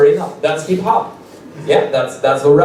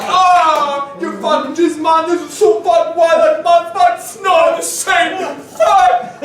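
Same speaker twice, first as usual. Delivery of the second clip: mock outraged rapper; 12 semitones higher than usual. Rapper explodes when asked to repeat punchline, mock fury. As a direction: lose it at being asked to repeat. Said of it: "And he was like".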